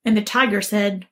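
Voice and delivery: plain reading voice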